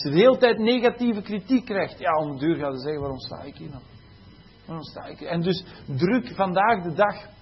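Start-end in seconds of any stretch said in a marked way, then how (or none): 3.0-5.3 soft, fading to a whisper; a hushed, defeated question; discouraged inner voice. Retold or imitated. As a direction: ask it to yourself, worn out and quiet. Teacher voicing discouraged inner thought.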